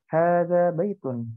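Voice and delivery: Hadza baitun drawn out slowly, each syllable clear; slow model reading. Shown as a demonstration. Teacher modelling Arabic for the online class, slow and clear. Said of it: "Ini rumah, ya".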